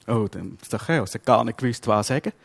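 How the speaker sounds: usual speaking voice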